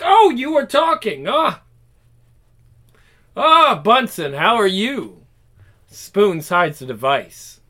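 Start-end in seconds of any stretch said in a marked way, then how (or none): 0.0-5.3 high, louder mock surprise; startled greeting. Spoons caught on his phone, startled greeting. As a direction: act caught off guard, pitched up.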